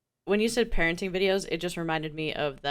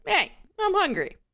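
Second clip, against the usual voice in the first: lightly voiced baby, pitched up; baby's demand; 11 semitones higher than usual. Host imagines a hungry baby speaking up, pitched-up mock demand. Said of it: "the baby was like".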